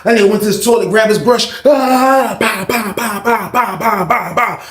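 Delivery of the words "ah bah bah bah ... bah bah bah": high, rhythmic banging imitation; repeated "bah" like steady banging; imitated annoying noise. Inmate banging for guards' attention, imitated annoying noise. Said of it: "hit an annoying noise".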